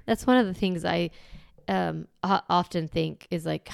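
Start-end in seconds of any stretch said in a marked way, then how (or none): none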